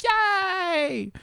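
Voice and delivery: high excited yay; celebrating the intro finally being done; cheer. Real, not acted. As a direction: squeal it with joy.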